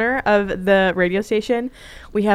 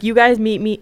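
ordinary talk, nothing marked

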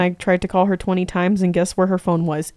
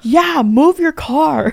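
high, drawn-out order; slow, pitched-up mock impatience; retold exasperated demand; 7 semitones higher, much slower than usual. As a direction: stretch it like stating the obvious. Acts out her parking demand.

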